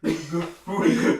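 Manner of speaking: Rudiger drawn out slow and loud; exaggerated slow Rudiger. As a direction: stretch Rudiger long with a rise. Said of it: "that's crazy how to say it".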